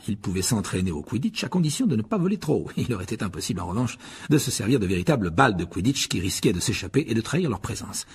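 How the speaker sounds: ordinary delivery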